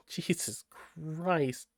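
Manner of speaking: exasperated aside. Real, not acted. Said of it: "reader aside".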